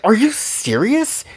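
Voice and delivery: high, slow incredulous question; can't believe what she just heard; shocked disbelief. Acted. Yukari hearing the core can rewrite the world, stunned. Everyone freaking out at the news.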